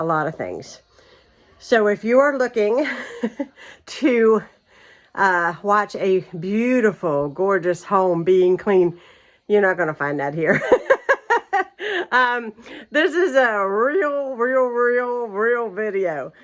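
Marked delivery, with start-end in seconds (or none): 2.9-3.4 brief amused chuckle; a quick little laugh at herself; short laugh. Real, not acted. Laughs at her own intro. 10.7-12.1 high burst of laughter; laughing. Real, not acted. Laughs at her messy house. 12.1-16.3 laughing. Real, not acted. Laughing through her words.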